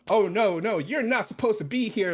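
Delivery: ordinary talk, nothing marked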